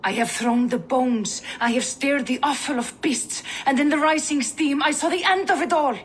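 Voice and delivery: rising prophetic frenzy. Acted. Seer recounts a vision.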